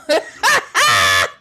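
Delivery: loud hearty laugh; real laugh at the comment; burst of laughter. Real, not acted. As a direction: crack up laughing.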